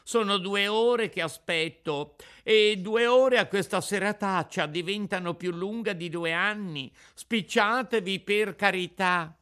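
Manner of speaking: begging snail to hurry, shouting; shouted plea. Acted. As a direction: yell your impatience up at the window. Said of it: "gridò Pinocchio dalla strada".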